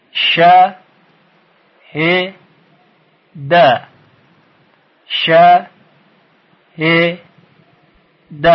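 "ش، ه، د" as "sha, he, da", long pauses between; short isolated syllables in a slow drill rhythm; split into syllables. Shown as a demonstration. Teacher gives another example, syllable by syllable.

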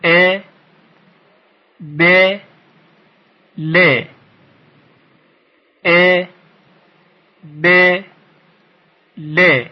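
"ا، ب، ل" as "e, be, le", long pauses between; measured syllable drill, each ending in short "e"; split into syllables. Shown as a demonstration. Teacher asks learners to repeat, syllable by syllable.

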